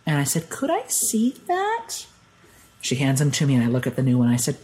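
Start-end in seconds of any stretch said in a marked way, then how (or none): 0.5-2.1 very high, slow question; retold jitters, high and drawn out; nervous request. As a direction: ask timidly, voice lifted right up. Nervous traveller asks to look.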